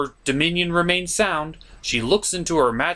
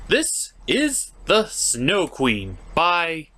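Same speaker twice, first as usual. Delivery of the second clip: slow struggling read; much slower than usual. Real, not acted. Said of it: "what is this word?".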